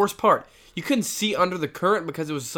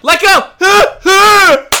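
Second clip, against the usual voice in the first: much higher, louder retold scream; urgent scream; 15 dB louder than usual. Friends yelling to boy on rope swing, frantic scream.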